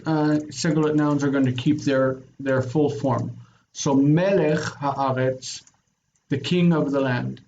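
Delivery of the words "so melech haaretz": melech haaretz slow, with a secondary stress kept on melech; slow model phrase. Shown as a demonstration. Teacher showing the student a secondary stress, slow. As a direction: keep a beat of stress on melech. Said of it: "keep its own secondary accent".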